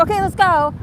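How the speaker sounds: a hammed-up impatient Dimitri; hurried mock Dimitri. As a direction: urge everyone out the door, eager. Dimitri rushing again.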